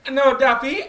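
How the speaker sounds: high, loud mimicked contradiction; kids insisting. Little kids correcting the visitor, high insistence. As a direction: insist like a teasing little kid.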